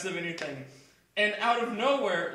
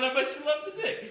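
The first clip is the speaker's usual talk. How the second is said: mock yell; 8 semitones higher than usual. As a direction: pretend to yell it, pitched up. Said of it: "I feel like yelling".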